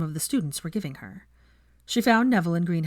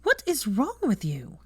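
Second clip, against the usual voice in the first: hotly; 6 semitones higher, slower than usual. A narrator's reading. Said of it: "she said hotly".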